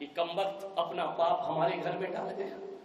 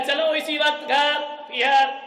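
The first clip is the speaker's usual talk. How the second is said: loud, higher order to leave now; angry demand; 8 semitones higher, 7 dB louder than usual. Acted. Bride orders her father to take her home, loud. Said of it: "लड़की ने कहा".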